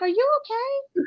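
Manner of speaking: high, soft, slow question; mock concern. Woman recounting her reaction to his wailing, mock concern.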